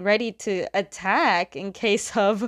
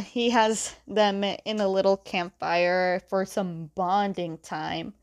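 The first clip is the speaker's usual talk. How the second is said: usual speaking voice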